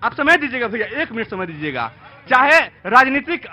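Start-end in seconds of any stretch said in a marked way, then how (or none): none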